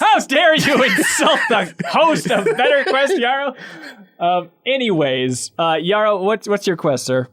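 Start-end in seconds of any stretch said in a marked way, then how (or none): 0.0-3.5 mock outrage. Mock offense at caller's joke.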